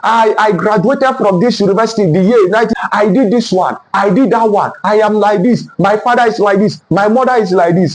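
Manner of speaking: normal talking voice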